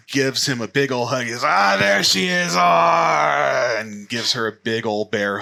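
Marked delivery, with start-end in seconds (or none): 1.4-3.8 loud, high, booming greeting; delighted grandfather. Acted. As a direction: shout with joy, stretching it out.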